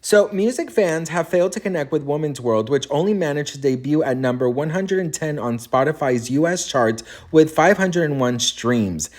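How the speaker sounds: ordinary talk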